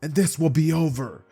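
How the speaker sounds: loud, high, drawn-out shout; full-force villain yell; screaming villain. Acted. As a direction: roar the threat as a villain. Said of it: "Overhaul would scream at Izuku".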